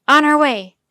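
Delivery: full-voiced emergency response; urgent yell. A narrator's reading. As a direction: yell back that help is coming. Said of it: "Jomo yelled into the radio receiver".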